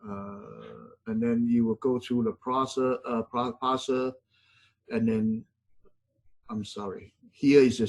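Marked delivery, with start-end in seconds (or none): none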